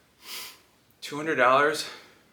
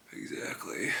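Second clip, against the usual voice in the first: whispered echo; 6 semitones lower, 10 dB quieter, slower than usual. Acted. Echoed in a whisper.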